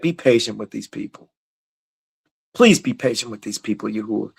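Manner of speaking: low, soft plea to God; hushed, personal prayer; quiet pleading prayer. Shown as a demonstration. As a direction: drop low and ask God gently. Models Moses pleading in prayer.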